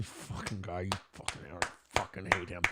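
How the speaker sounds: low, very soft furious mutter; seething mutter. Shown as a demonstration. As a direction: grumble the hate under your breath. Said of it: "she's definitely in seethe mode".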